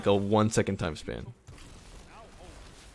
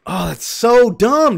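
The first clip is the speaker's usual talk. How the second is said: loud, higher, slow angry complaint; frustrated yell; 10 semitones higher, 8 dB louder, slower than usual. Real, not acted. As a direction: drag out the frustration. Frustrated again.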